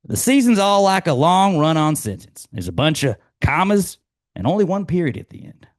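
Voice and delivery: Ted Lasso quote. Acted. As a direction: play it like a chipper coach.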